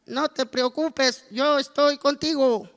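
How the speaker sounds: high, loud, slow squawking parrot; a parrot's high squawk, drawn out. Acted. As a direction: play the parrot talking back from behind. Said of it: "habla el loro por allá atrás".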